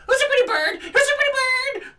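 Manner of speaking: high screeching parrot squawk; parakeet chatter. Acted. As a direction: screech it like a pet bird. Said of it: "the screeching of".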